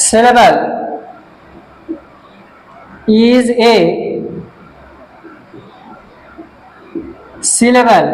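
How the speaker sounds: slow word-by-word dictation with long pauses; dictating while writing. Real, not acted. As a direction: spell it out piece by piece for students copying it down. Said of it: "আমি লিখে দিচ্ছি". (লিখতে লিখতে থেমে থেমে, ধীরে বলে দেওয়া)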